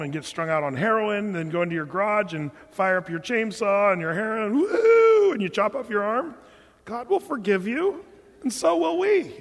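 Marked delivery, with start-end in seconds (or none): 4.6-5.3 high shouted whoop; a wild party cheer; sudden high yelp of glee; mock whoop. Mock heroin user cheering.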